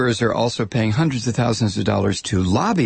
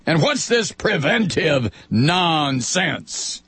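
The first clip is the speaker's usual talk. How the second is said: high sneering witch voice; 6 semitones higher than usual. Acted. Insurers voiced.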